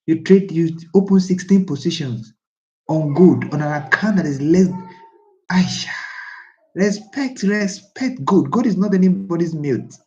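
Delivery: a real telling-off, high and loud; heated scolding. Real, not acted. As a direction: tell Aisha off, really worked up. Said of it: "I'm sorry I'm talking like that".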